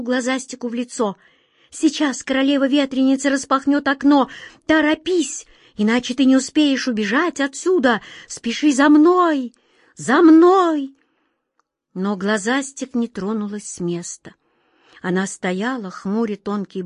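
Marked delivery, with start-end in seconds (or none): 1.7-10.9 high, loud urging; urgent hurry. A narrator's reading. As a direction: hurry her along, almost shouting. Whirlwind urges her to flee.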